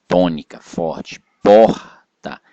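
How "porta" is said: "Porta" with a loud, higher POR and a separate, weaker TA; stress on POR. Shown as a demonstration. Teacher demonstrating the strong syllable, stress on POR in "Porta". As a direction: punch the first syllable of "Porta". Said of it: "tem uma sílaba que é mais forte".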